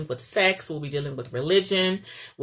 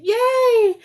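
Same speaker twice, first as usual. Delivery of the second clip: cheer; 7 dB louder than usual. Real, not acted. Cheering at end of video.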